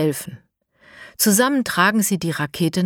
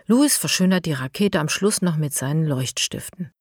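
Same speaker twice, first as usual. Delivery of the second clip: ordinary delivery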